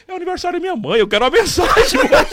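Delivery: high-pitched, loud comic plea; pleading cyclist. Retold or imitated. Cyclist finally catching the driver, high comic plea. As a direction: squeak it out like a breathless guy begging. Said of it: "Ele falou".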